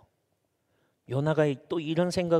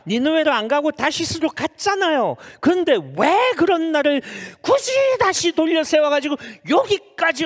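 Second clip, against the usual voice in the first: high, indignant acted complaint rising louder; fed-up Jonah; 8 semitones higher, 4 dB louder than usual. Jonah complains to God about Nineveh, acted fed-up rant. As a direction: rant upward like an exasperated youngster. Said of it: "그냥 빡쳐가지고 하나님 앞에".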